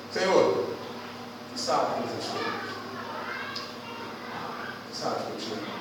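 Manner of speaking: drops low and soft to a near-whisper on "Tu sabes que eu te amo"; Peter, grieved at being asked three times; hurt, fading answer. Acted. Peter answers the third time. (resposta magoada, quase sussurrada)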